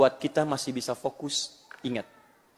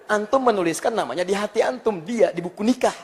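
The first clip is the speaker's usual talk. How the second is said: normal talking voice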